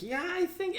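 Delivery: high-pitched mock-eager reply; excited imitation. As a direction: imitate someone all excited. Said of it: "he's all excited about it".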